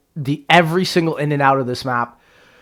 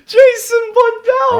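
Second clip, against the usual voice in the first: mock wail; slower than usual. Mock anguish over developer.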